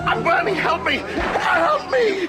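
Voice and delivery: high, frantic shouts for help; screaming in agony. Acted. Burning man screaming to Mac for help. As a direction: scream as the flames take you.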